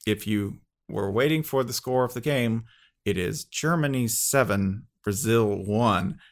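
soft, measured intercom announcement, slowing on the score; flight attendant announcement. Retold or imitated. Flight attendant gives passengers the score, soft measured announcement. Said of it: "flight attendants picks up the, the intercom and goes".

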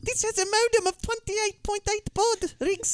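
high, lilting accented impression; Kira. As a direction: plead in a put-on high accent. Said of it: "He never likes our Kira impression".

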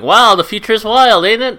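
put-on high, loud old-timey boy voice; hammed-up wide-eyed lad from the twenties; 1920s little boy. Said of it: "it's a little boy in the nineteen twenties going".

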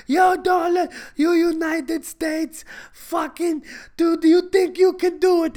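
high, strained, loud rant; hammed-up raging foreign leader; leader yelling. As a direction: scream over the other person on a call. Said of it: "why are you yelling".

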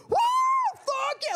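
high whooping victory yell; wild cheer. Retold or imitated. Passengers landing, wildly whooping and cheering. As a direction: whoop it up like you won the final. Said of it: "This was, like".

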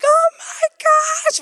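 high, slow, loud distressed cry; panicked wail. Retold or imitated. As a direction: wail it out in shock over the broken tooth. Distressed after tooth breaks.

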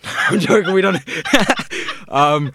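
bursting into a high laugh; loud laughing retraction. Real, not acted. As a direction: laugh the joke off. Laughs after joke about children.

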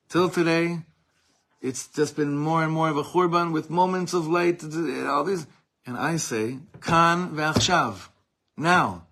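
preaching at full force. Acted. Preacher rallying congregation, fervent full-force preaching. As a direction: drive the refrain home to the crowd.

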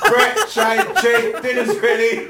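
yelling across the room. Pretend. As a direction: call the kids in from another room. Mum calling kids to dinner.

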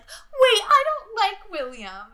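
very high, loud mock-child wail; loud kid protest. Kid must announce he changed his mind, loud protest. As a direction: imitate a little kid blurting a drawn-out objection. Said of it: "having to be like".

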